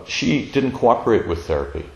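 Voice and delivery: normal talking voice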